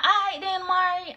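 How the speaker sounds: high, bright sing-song farewell; sing-song goodbye. Retold or imitated. Friends in the doorway teasing her, high sing-song call.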